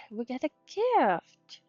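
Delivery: ordinary talk, nothing marked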